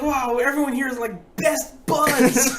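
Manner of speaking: high, loud, slowed on best buds; drawn-out wonder; amazed. Retold or imitated. Marveling at friendly fishers, high and loud with slow ending.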